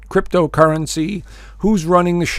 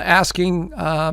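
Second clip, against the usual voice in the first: ordinary delivery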